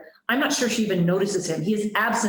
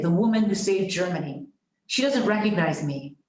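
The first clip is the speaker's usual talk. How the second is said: ordinary delivery, nothing marked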